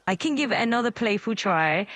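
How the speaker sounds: ordinary talk, nothing marked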